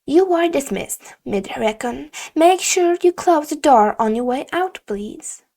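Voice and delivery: stern teacher ending the meeting; curt teacher dismissal. Acted. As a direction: send him out briskly.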